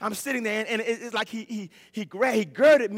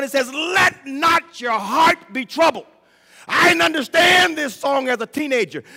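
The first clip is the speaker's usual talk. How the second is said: full-force preaching; 4 semitones higher, 6 dB louder than usual. Acted. Pastor testifying to the congregation about his faith, fervent preaching. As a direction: preach it out with fervor.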